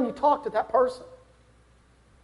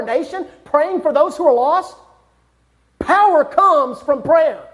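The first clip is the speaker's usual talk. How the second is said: raised, driving preacher's voice, building; preaching at full force; 5 semitones higher, 4 dB louder than usual. Acted. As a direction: preach it out to the congregation.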